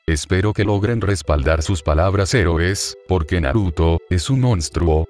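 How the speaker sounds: ordinary delivery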